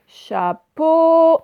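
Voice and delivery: "Chapeau" loud and high, "peau" held long; drawn-out final syllable. Shown as a demonstration. Teacher showing where O is heard, final syllable drawn out. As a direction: stretch the O at the end of "Chapeau". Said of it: "on l'entend à la fin".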